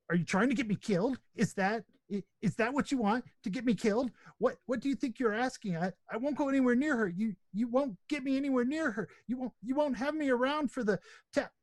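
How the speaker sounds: high, panicky nervous creature voice; a jittery little creature, terrified of being killed; frightened creature. Acted.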